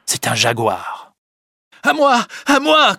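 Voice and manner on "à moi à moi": high, loud cry for help; cri à l'aide. Acted. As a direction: shout for help in terror. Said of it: "crie une voix". (appel au secours crié, très aigu)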